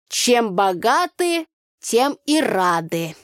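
slow, drawn-out folksy shrug; shrugging proverb. Acted. Tanya shrugging at her friend, slow. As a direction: spread your hands and shrug it off. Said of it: "развела руками Танюха".

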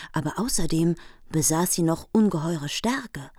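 ordinary delivery

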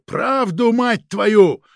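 loud, high demand, exploding; sudden furious shout. A narrator's reading. Chief loses patience with evasive agent, sudden loud shout. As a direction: bellow at him to force it out. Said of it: "неожиданно закричал начальник сыскной, да так сильно".

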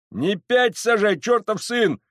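high, slow, loud scolding; angry cursing shout. Acted. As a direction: swear at him as you shove him along. Crowd cursing while dragging him.

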